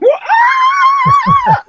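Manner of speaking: high, loud laughing rock wail; mock rock scream. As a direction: belt a huge stage scream. Singer belting on stage.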